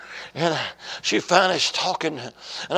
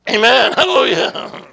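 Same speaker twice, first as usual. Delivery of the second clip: loud, raised shout of praise; exultant praise; 5 semitones higher, 9 dB louder than usual. Real, not acted. Preacher praises before the church, shouting exultantly. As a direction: burst out with it.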